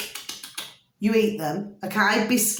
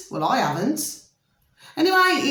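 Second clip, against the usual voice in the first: ordinary delivery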